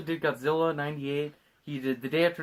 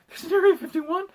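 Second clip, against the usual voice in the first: high, loud echoed disbelief; exaggerated incredulous question; mock astonishment; 13 semitones higher, 5 dB louder than usual. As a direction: parrot the shock in a raised, hammy pitch. Second character echoes the shock.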